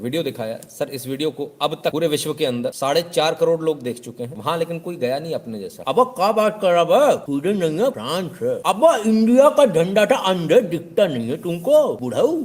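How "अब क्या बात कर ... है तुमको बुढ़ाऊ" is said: much higher and louder, slow derisive yell; comic sneering rant; mock scolding shout. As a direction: berate the old man loudly. Commenter scolds the host.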